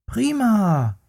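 high happy little bear exclaiming; delighted cry. Acted. Apple lands on the little bear's belly, delighted high cry. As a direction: call out with glee like a cub. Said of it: "rief der kleine Bär".

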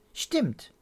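put-on high, short child's agreement; agreeing child. A narrator's reading. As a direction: agree promptly like a little girl. Pia agrees with Pitt.

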